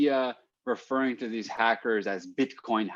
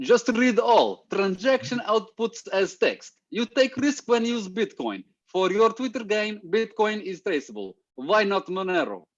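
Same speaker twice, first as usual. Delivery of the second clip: hammed-up Russian accent, pitched much higher; over-the-top Russian accent imitation; 10 semitones higher than usual. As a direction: read it like a comic Russian villain, high and slow. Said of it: "only with a Russian accent".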